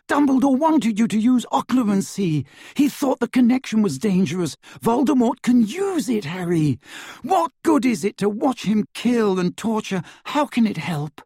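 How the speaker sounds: high, loud, urgent pleading; distressed cry. A narrator's reading. Hermione warning Harry the connection is dangerous, crying out. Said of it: "Hermione cried, her voice echoing".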